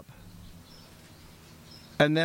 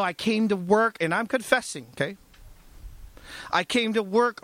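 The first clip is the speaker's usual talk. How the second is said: ordinary delivery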